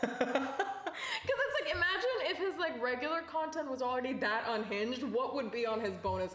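giggling while building the joke; cracking up at the thought of his bonus content; laughing. Real, not acted. Laughing about Trump bonus content.

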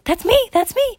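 high, loud excited squeal; excited child. Acted. Boy hearing his name drawn, excited. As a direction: pipe up as the winning kid.

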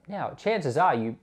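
ordinary talk, nothing marked

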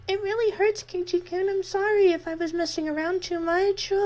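put-on hurting girl begs forgiveness; pained apology. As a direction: plead through pain. Reina pleading in pain.

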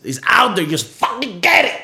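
loud, high, exasperated push; worked-up sales yell; emphatic shouted plug. Real, not acted. Hyped plug for his podcast.

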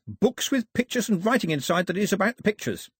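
high-pitched keen listing; eager islander. A narrator's reading. Pilou finishing his list of gifts, eager. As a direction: keep the wish list going, high and keen.

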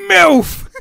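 high, very loud shout; screamed outburst. Acted. Co-host plays the hat on Professor McGonagall, high loud shout. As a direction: blurt it at full volume as the hat. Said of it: "and goes".

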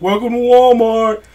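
a hint of a cheesy store greeter; mock greeter voice. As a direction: mimic a Walmart greeter to mock him. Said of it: "she goes".

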